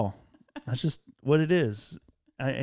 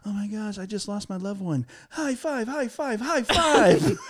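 higher, chirpy sing-song high fives; cheerful texter; 7 semitones higher than usual. Retold or imitated. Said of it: "but it was".

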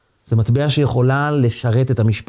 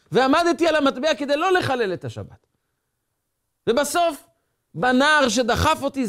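high, pained, pleading cry; boy pours out his pain to the rabbi, high and anguished; anguished outburst; 13 semitones higher than usual. Acted. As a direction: let the hurt burst out of him, voice up high. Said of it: "ואז זה התפרץ".

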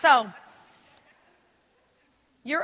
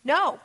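a flat, firm no said louder; 3 semitones higher, 6 dB louder than usual. Shown as a demonstration. Preacher demonstrating the boundary word, firm no. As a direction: plant the word no like a fence post. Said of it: "and that word is no".

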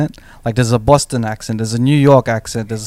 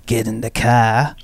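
slow, drawn-out Aussie bogan; broad Australian accent; slower than usual. Shown as a demonstration. Showing friends the unsexy Australian accent, broad and slow. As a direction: lay on a slow Australian twang. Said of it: "when they hear someone say".

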